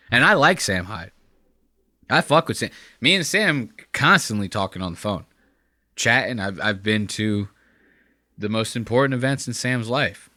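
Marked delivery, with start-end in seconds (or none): none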